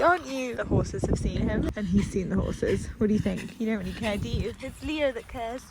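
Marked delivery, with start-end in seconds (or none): none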